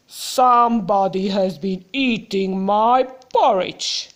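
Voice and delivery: lightly voiced big bear, low rumble; storyteller doing a mild loud growly Papa Bear; Papa Bear's growly voice. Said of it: "in a loud, growly voice".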